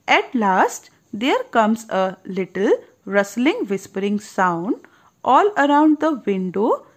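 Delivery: slow read-aloud, words stretched; storybook narration. A narrator's reading. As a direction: tell it slowly to a child. Storybook line read slowly. (धीमी कहानी वाली आवाज़)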